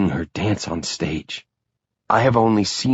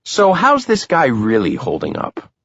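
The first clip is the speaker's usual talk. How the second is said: joking; 6 semitones higher than usual. A narrator's reading. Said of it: "I joke".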